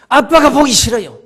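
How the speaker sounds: mildly hammed-up childish protest; boy's blurted answer. A narrator's reading. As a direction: blurt it out as a defiant boy. Boy answers police with his reason.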